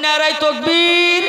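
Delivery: loud, high, slow rallying cry; takbir call. Acted. Preacher leading the crowd in a loud takbir call.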